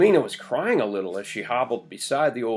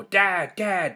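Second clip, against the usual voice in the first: yell; 7 semitones higher than usual. A narrator's reading. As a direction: call for Dad through a hole. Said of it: "have to stop and yell".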